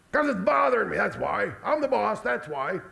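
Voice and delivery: lightly acted bossy parent, punching each reason; exasperated dad. Dad answering a child's why, exasperated. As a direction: play a dad laying down the law. Acting out an exasperated dad's reply.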